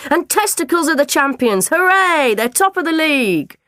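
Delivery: high, loud triumphant cheering; boy whooping over his imaginary champions; excited cheer. Acted. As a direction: shout it like the winning goal went in.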